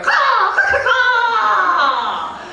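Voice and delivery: loud, high-pitched crow shriek; screeching bird caw. Acted. Uncle imitating the camp robber bird, screeching.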